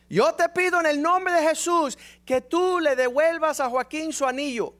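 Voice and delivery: hammed-up old woman calling on God; praying old woman. Imitating her prayer again.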